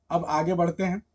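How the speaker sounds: normal talking voice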